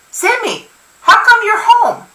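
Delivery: put-on high-pitched boyish voice; high, young boy's voice; boy's surprised question. A narrator's reading. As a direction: ask it like a puzzled little brother. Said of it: "he mumbles, noticing me".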